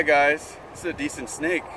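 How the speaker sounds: usual speaking voice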